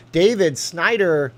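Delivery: slow booming host read of a donor name; announcer voice. Acted. Streamer hyping a donor's tip, booming announcer read. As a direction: announce the supporter like a game-show host.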